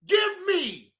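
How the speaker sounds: whiny mock plea of give me, give me; mocking beggar. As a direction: mimic someone begging, high and pleading. Mocking people asking for handouts.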